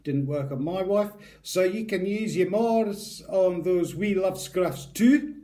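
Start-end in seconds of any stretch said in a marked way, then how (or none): 1.4-5.3 slow, cheeky put-on Scottish accent; mock Scottish accent. Vlogger joking about the butter's aphrodisiac claim, in a mock Scottish accent. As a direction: savour wee love scruffs like a Scot. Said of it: "I keep breaking into Scottish accent".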